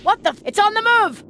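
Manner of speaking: loud, high panicked yell; alarmed shout. Acted. Commander yelling to the squad as the target runs.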